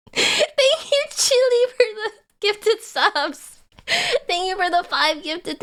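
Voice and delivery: high and giddy, slowing at the end; thanking a viewer for subs, high and giddy; excited thanks. Real, not acted. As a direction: gush the thanks with a laugh. Thanking after laughing.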